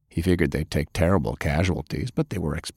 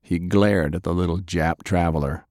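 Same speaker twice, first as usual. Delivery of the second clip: ordinary delivery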